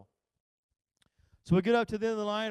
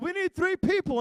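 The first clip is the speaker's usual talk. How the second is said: staff call-out; 8 semitones higher, 7 dB louder than usual. Retold or imitated. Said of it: "they come out and said".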